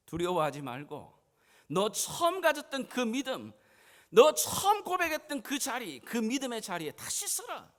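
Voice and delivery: loud command building to a high cry; forceful exhortation. Acted. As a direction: start firm, then rise into a rousing shout. 예수의 말, 힘찬 두 번째. (점점 높고 크게 외치는 명령)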